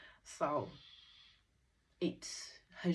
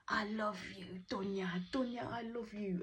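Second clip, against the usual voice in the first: high-pitched mock call, no real volume; imitated love shout; 6 semitones higher than usual. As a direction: act out a lovestruck call, pitched up. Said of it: "he basically shouted out".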